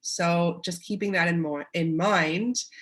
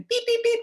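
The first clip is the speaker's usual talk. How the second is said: high, clipped beep noises like a timer; high mock timer beeps; 4 dB louder than usual. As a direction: play the freezer going beep.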